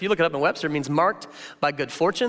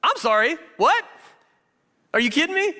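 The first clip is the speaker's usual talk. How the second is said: mock disbelief; 11 semitones higher than usual.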